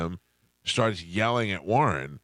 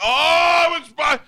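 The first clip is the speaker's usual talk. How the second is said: high, loud shriek of dismay; a sudden startled outburst; shocked outcry; 9 dB louder, slower than usual. Retold or imitated. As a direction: yelp it out in disbelief. Seeing the video cut off.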